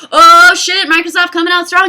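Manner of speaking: loud, high burst of excitement; hyped fan reaction. Acted. Acting out fans at the console reveal, hyped. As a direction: belt it out like an amazed fan. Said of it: "We would have been like".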